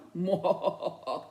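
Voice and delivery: a brief nervous chuckle; short laugh. A narrator's reading. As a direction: laugh at your own joke. Narrator laughs at own joke.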